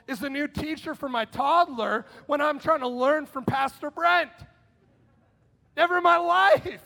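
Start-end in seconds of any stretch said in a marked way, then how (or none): 5.8-6.8 shouted higher and louder exclamation; mock outburst. Preacher mimics a parent fed up at home, shouting. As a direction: blurt it out loud.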